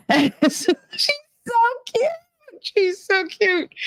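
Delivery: high-pitched squeal of adoration; gushing. Real, not acted. Gushing over a little girl in a clip, squeally cooing. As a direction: coo it very high through giggles.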